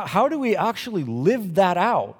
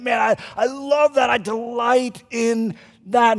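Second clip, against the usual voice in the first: delighted gush; 7 semitones higher, 4 dB louder, slower than usual. Retold or imitated. Enthusing as a delighted person.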